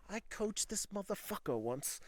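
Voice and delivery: a bad, drunken-sounding Irish accent; failed Irish accent. Coach retelling story years later, clumsy Irish accent. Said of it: "I don't know how to do an Irish accent".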